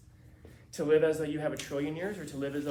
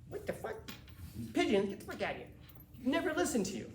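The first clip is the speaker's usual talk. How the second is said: hammed-up scoffing at the pigeon idea; mock incredulous boxer; 8 semitones higher than usual. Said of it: "If you go to that guy and you go".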